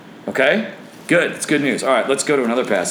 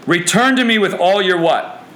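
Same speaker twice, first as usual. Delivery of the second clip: high, loud call to the congregation; preacher's proclamation; 6 semitones higher, 4 dB louder than usual. Acted. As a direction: proclaim it forcefully, then prompt them. Proclaims verse, prompts the congregation.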